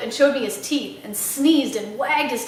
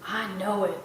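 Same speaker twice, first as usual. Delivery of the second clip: quiet weary reply; 6 semitones lower, 8 dB quieter than usual. Acted. Otis answering the parrot.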